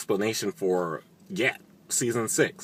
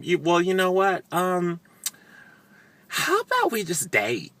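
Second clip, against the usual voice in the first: higher, coy put-on voice; mock Sookie; 9 semitones higher than usual. Sookie stalling Warlow at the wedding, in a coy mocking voice. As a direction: play her as sweetly dodging.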